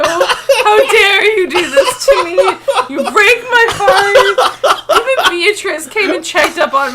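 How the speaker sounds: high, loud half-crying through laughs; hammed-up betrayal, laughing; laughing mock heartbreak. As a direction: play wounded while cracking up.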